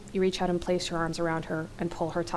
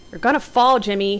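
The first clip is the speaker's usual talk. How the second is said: raised, motherly warning; almost motherly; 2 semitones higher, 8 dB louder than usual. A narrator's reading. Heather warning Jimmy at the bow, almost motherly. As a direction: scold lightly like a concerned mother. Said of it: "almost motherly".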